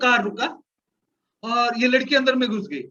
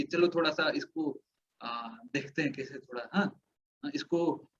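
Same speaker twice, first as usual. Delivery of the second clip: much softer and lower, plotting in a low hush; conspiratorial hush; 8 semitones lower, 9 dB quieter than usual. Retold or imitated. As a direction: keep it down, scheming with a friend. Plotting quietly with mission student.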